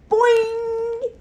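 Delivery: high, loud springy frog call; boing like a bouncy frog; frog's boing. A narrator's reading. Frog answers the hamster with a loud high boing. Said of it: "he answered".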